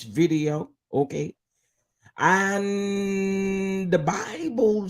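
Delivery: slow, high, stretched sermon; preacher lady voice. Acted. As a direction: drag out the words like a church lady. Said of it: "put on my preacher lady voice".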